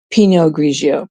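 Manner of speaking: usual speaking voice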